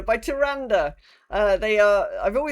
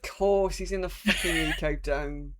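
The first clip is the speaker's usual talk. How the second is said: exasperated; 6 dB louder than usual. Real, not acted. Sudden exasperated realisation.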